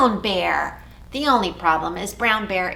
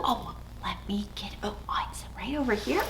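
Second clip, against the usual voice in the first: whisper; 11 dB quieter, faster than usual. Acted. Whispered aside.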